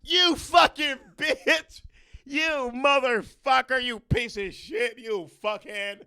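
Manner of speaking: high, slow, full-throated cursing scream; bratty kid screaming at a deaf stranger; screaming insults. Retold or imitated. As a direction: scream the insults as loud as you can. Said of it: "we'd be like".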